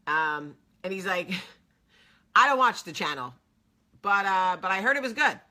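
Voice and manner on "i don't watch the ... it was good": loud, blunt imitation of a friend; imitating his talk. Old friend admits not watching her show, loudly mimicked. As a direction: push it out loud, mimicking how he talks. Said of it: "This is how he talks".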